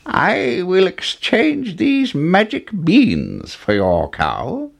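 put-on high, slow old man's voice. A narrator's reading. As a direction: offer it slowly, pitched high, as an old man. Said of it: "said the man".

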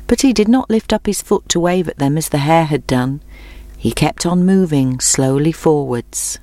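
ordinary delivery

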